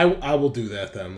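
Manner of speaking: normal talking voice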